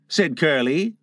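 plain reading voice

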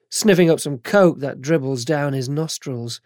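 plain reading voice

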